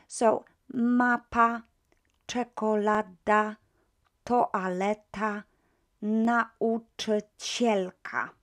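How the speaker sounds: mapa, czekolada, toaleta, nauczycielka said slowly and loud; slow careful pronunciation. Shown as a demonstration. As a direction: model each word slowly. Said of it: "pronounce them first".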